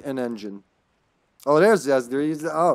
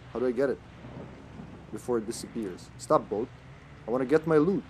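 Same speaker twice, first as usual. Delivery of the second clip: ordinary delivery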